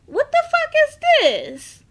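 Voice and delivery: high, loud what-the-fuck shock; shocked outburst. Retold or imitated. Family walking in, shocked, high and loud. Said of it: "they walk in like".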